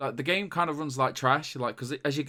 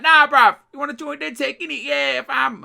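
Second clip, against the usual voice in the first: much higher and louder hammed-up London street accent; over-the-top London accent; 12 dB louder than usual. Mimicking a game recruit to friends, over-the-top London accent. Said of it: "Rick Cockney".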